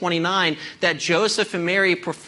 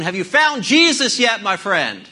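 harsh tone; 2 semitones higher, 4 dB louder than usual. Acted. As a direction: press the question hard and impatient. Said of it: "says in a rather harsh tone".

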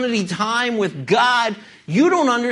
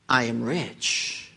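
slow, sinking smug boast; 11 semitones lower, 7 dB quieter, much slower than usual. Retold or imitated. Preacher voicing the smug church at Laodicea, slow and low. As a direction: drawl it out like a self-satisfied rich man. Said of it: "Because you say".

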